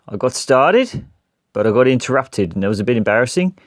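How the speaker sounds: mock New Zealand accent, deck said like dick; hammed-up Kiwi accent, rising in spots. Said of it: "Terrible accent, bro".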